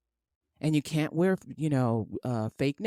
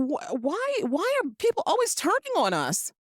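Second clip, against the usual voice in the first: higher, pleading whine at everyone; higher mock complaint; mock exasperated question; 10 semitones higher than usual. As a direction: ask it up high, baffled and hurt. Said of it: "I'm like".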